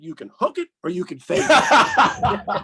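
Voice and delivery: laughing punchline. Retold or imitated. Punchline to stubborn player.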